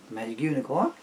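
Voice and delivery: plain reading voice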